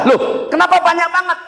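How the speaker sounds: high, loud astonished cry; mock shock at the pile of words; surprised. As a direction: gasp out in disbelief that it's so much. Recipient shocked at long message.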